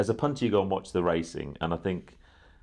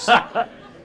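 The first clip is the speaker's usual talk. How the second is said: loud laugh; 11 dB louder than usual. Real, not acted. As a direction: burst of high laughter. Laughing at ape joke.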